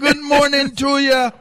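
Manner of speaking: put-on very high parrot squawk with a Jamaican accent. Imitating [name]'s parrot, very high Jamaican accent. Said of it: "Did he have a Jamaican accent?".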